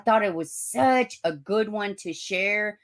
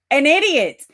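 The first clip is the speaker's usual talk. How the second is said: loud and higher, hammering the insult; emphatic; 6 semitones higher, 9 dB louder than usual. Shown as a demonstration. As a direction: belt it out at herself.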